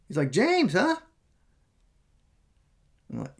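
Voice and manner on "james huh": high, loud, awkward questioning echo; weirdly over-interested. Retold or imitated. Stranger repeating his name back, weird and awkward. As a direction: imitate an oddly friendly stranger checking a name. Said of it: "so weird about it".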